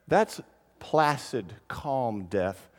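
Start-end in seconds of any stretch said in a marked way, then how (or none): none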